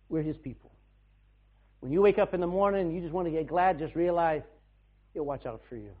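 ordinary delivery

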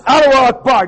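loud, high-pitched shouted chant; imitated yelling. Correcting the man in the headlock story, shouted imitation. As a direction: belt it out as a mock battle cry. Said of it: "he's yelling at me like that".

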